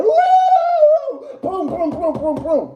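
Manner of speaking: very high whoop then booming thuds; mock whoop and booms. Describing the bad road, hammed-up whoop and booms. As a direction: ham up a wild whoop and banging. Imitating washing machine sex noise.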